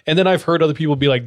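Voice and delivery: usual speaking voice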